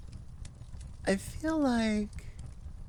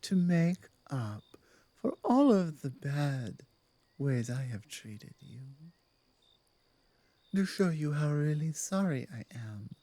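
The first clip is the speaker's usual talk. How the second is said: apologetic werewolf pack leader; 4 semitones lower than usual. Acted.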